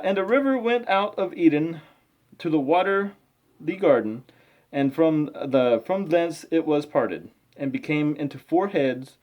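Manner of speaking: slow, measured scripture reading. Acted. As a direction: read it out like a careful lesson.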